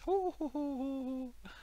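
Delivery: high hammed-up Geordie chuckle; Sarah Millican mock laugh. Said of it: "Oh, Sarah Millican".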